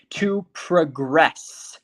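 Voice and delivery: very slow "To progress", weight on GRESS; stress on second syllable. Shown as a demonstration. Teacher lets students hear the emphasis, "progress" stressed on GRESS. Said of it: "You hear the emphasis".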